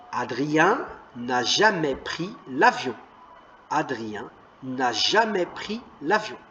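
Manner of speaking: phrase lue lentement, bien articulée; lecture lente. Shown as a demonstration. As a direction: lis lentement pour que les enfants suivent. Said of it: "lisez-la deux fois". (lecture modèle lente)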